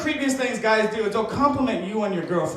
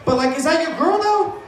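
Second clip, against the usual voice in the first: pushy bar guy; 7 semitones higher, 5 dB louder than usual. Retold or imitated. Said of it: "he's like".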